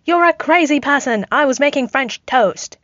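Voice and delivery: Rhodey protests, pinned, in a lightly voiced yell. A narrator's reading. Rhodey pinned by Steve, yelling his innocence. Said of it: "Rhodey yelled".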